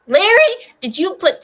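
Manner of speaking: loud high yell with the voice cracking; voice cracking scream. Real, not acted. Streamer yelling at moderator Larry as voice cracks. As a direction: scream it and let the voice break. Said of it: "did my voice crack".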